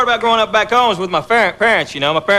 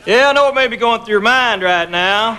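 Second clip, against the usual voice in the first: easygoing Appalachian accent. Real, not acted. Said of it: "so I do talk this way".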